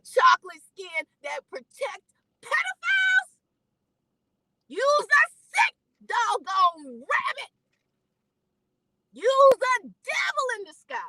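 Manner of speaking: high, loud, furious rant; raging at the men online; furious shout. Real, not acted. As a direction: shout the insults out in rage. Angry rant at online defenders.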